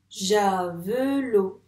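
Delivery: "javelot" split ja... ve... lo, loud and slow; teacher's slow ja, ve, lo; syllable by syllable. Shown as a demonstration. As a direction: clap out "javelot" in three separate beats. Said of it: "Il y en a donc".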